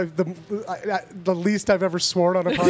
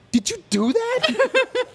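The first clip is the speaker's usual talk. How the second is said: much higher, slow, drawn-out accusing question; mock scolding; much slower than usual. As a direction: drag it out like a teacher catching him. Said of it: "I'm chewing out this kindergartner".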